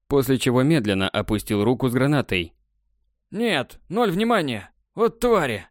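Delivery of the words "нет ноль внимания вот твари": high, exasperated cursing; frustrated outburst. Acted. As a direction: throw up your hands and swear. Partner swears in frustration.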